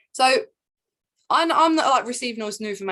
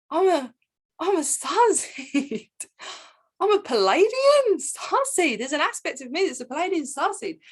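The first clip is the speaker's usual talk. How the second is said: half-laughing thrilled squeal; giddy disbelief; 8 semitones higher than usual. Retold or imitated. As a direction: savour each word like a revelation. Retells her own thrilled reaction.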